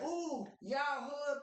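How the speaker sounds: high, slow, drawn-out mock gush; a hammed-up man thrilled at the news; gushing delight. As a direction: stretch it out like he can't believe it. Retelling a man gushing over news.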